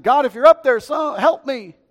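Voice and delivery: loud, high acted plea; desperate cry out. Hiker on the cliff cries to God, desperate. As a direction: shout it upward, pleading. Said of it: "He cried and cried".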